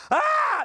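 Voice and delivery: high-pitched mock cry of amazement; exaggerated high gasp of wonder; amazed "Ah!". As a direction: let out a high, wowed "aah" like a fooled spectator. Acting out an amazed audience.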